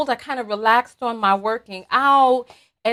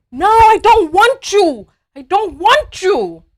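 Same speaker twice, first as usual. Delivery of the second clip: high, loud, drawn-out protest; loud protesting refusal; 8 semitones higher, 7 dB louder, slower than usual. Acted. Dieter answering seductive ice cream, loud protest. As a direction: push the temptation away at full voice. Acted refusal of tempting food.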